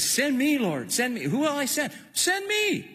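high, eager cry to be sent; eager plea. Acted. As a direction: plead to be sent, reaching up high. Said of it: "when it says that Isaiah says".

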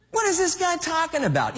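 high-pitched incredulous complaint; baffled. Retold or imitated. Voicing Abner's baffled reaction.

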